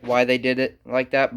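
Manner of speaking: normal talking voice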